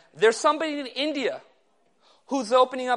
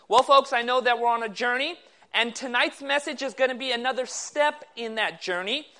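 normal talking voice

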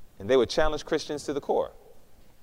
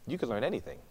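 "You can learn anything" repeated much softer, nearly hushed; soft echo; 7 semitones lower, 10 dB quieter than usual. Retold or imitated. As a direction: let the repeat sink in quietly.

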